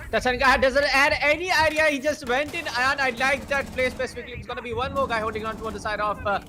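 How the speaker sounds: high, loud burst of commentary; excited play-by-play. Real, not acted. Caster reacting as Tachanka goes in, excited. Live call as action erupts.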